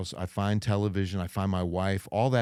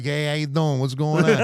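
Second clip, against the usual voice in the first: louder, higher Jersey greeting; Jersey accent; 5 semitones higher, 5 dB louder than usual. Retold or imitated. Jersey guy asked by Cajun girls to talk, hammed-up Jersey accent. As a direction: play up a New Jersey accent. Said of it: "Talk for us".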